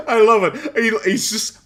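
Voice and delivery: laughing through the words, bursting out loud; real laughing fit. Real, not acted. Co-host delighted by the guest's alpha male remark, laughing hard. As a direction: crack up while echoing a boast.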